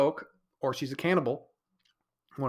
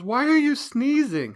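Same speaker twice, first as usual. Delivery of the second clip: high, slow, louder imitation of her question; drawn-out concerned whine; mock girlfriend voice; 12 semitones higher, 8 dB louder, slower than usual. Mimicking his girlfriend asking why he's sneezing, high and slow.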